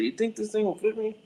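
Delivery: high, goofy teasing dad; embarrassing dad joke. Retold or imitated. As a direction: tease the kid with a silly ask. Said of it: "I say".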